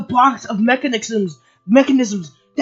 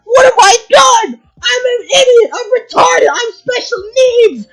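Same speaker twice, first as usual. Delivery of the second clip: high, loud mock sobbing wail; mock wailing Stolas; 9 semitones higher, 5 dB louder than usual. Mocking Stolas's breakdown as a high loud wail. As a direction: ham up a whiny breakdown, shrieking each line. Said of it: "snatches like".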